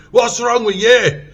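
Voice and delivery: drawn-out Scottish shout, high and loud; Scottish accent. Retold or imitated. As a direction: imitate a mad Scottish corporal bellowing. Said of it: "This is my best Scottish accent".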